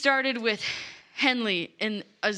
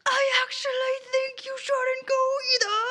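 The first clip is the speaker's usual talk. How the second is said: mock whiny plea; 13 semitones higher than usual. Roscoe pleads too.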